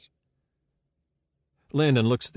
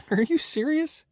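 very high, disbelieving question; incredulous. Acted. Witness reacting to being asked about the killer's mood, incredulous. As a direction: shoot the pitch right up in disbelief.

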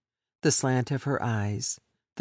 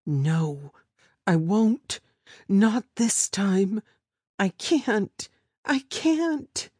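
high, pleading victim voice, slow on not this time; frightened woman refusing, voice climbing; desperate refusal; 7 semitones higher, 3 dB louder, slower than usual. Acted. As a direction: beg him, higher with each I can't.

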